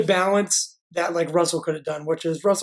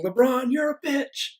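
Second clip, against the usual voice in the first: higher, drawn-out mock yell; retold yell; 6 semitones higher, slower than usual. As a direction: imitate a heckler yelling, stretched out. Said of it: "caught on video just yelling".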